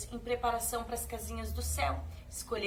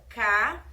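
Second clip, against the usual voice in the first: "K" (ca) said alone, loud; first syllable sounded; 8 dB louder than usual. Shown as a demonstration. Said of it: "tracinho pra indicar que a gente tá separando". (primeira sílaba "ca" bem alta)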